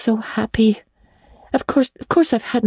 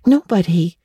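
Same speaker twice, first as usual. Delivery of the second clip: ordinary delivery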